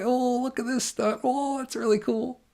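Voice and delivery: high, loud, delighted squeal at the stunts; gleeful joy. Retold or imitated. Couple laughing at a stunt movie, gleefully excited. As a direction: gush like a kid watching fireworks. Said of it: "gleeful joy".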